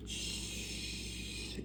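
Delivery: a whispered shh; shushing. Acted. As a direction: hush the penguins behind you. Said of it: "Seymour was on the backpack going".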